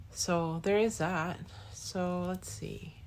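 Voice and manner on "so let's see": whispered musing. Real, not acted. Musing to herself.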